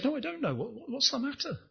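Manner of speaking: lightly acted surprise, high and soft; puzzled reply. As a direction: sound caught off guard by the question. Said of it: "I said".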